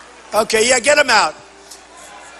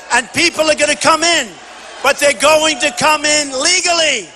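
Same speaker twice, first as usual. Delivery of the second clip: high, emphatic, full-force rally finale; rousing rally speech; 5 semitones higher than usual. Real, not acted. Candidate promises the rally crowd endless wins, rousing and high-pitched. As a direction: drive each promise home to a cheering crowd.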